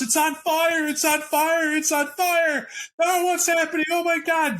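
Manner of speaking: high, frantic cries that the car is burning; panicked screaming. Acted. Trapped passengers scream from the locked cop car, high and panicked. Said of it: "they are screaming and banging on the windows".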